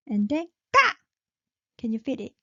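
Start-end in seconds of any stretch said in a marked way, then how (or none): 0.7-0.9 까 said tense, higher and louder; high-pitch tense 까. Shown as a demonstration. Teacher models tense 까 for learners. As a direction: punch out 까 high and tight. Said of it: "this is a high pitch".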